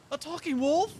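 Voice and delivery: high loud incredulous question; mock surprise. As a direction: fake astonishment. Mocking the wolf's line.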